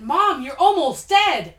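acted shock, loud and slow; gasped exclamation. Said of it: "she gasped and said".

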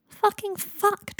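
high, slow anguished cry. Acted. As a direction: wail it out in horror. Harry horrified, biting knuckles.

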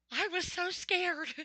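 put-on high, slow, teary child's voice; mother imitating her scared daughter, high and slow. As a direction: mimic a frightened little girl sobbing it out. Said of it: "she's like".

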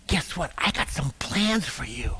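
put-on teasing secret to a child. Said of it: "Pick a, a child about that big and say".

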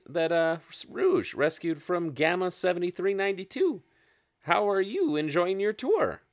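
high little owl-creature voice; Sparks the owl. Acted. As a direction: chirp it like a busy tiny owl. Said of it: "as she continues to speak".

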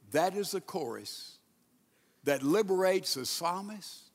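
normal talking voice

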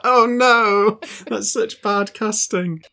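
high-pitched exaggerated dismay; hammed-up horrified reaction; mock dismay. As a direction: wail it as a comic protest. Acts out own dismayed reaction.

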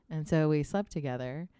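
ordinary delivery

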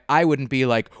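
ordinary talk, nothing marked

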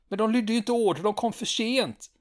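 high-pitched indignant protest; indignant soldier. Retold or imitated. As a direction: whine that the rules were broken. Stanneman protests to lieutenant.

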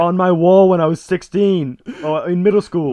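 high, loud starstruck outburst; mock fangirl gushing. Retelling the girl meeting her teen crush, mock gushing. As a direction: gush like a hammed-up superfan.

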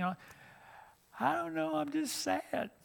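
put-on a girl lost in feelings she can't name; sad girl. A girl tells her youth minister she's just sad, slow and high.

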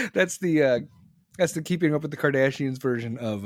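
ordinary talk

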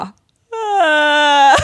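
high, long, hammed-up sob; mock crying wail. Bartender breaks down before the customer, mock bawling. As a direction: imitate bursting into tears. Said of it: "jag bara börjar gråta".

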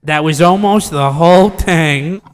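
slow, drawn-out mimicry; mocking echo. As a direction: echo it back slowly, stretching each word. Slow mocking echo of previous speaker.

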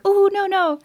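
high squeaky mock fright, pleading no; mock scared girl.

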